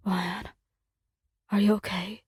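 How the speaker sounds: low, softened voice, mildly strained; a touch of pain in a subdued question. A narrator's reading. Injured man checks on partner after crash, mildly strained. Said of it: "his voice strained with pain".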